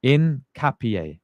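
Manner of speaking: slow, syllable by syllable: "Incapié" as in-ka-pi-AY; careful pronunciation. Shown as a demonstration. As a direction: sound out "Incapié" slowly, stress on the last syllable. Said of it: "pronouncing Inaka P".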